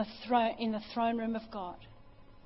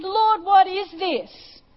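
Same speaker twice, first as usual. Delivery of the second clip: awed question; 9 semitones higher, 12 dB louder, much slower than usual. Retold or imitated. Retold question to God.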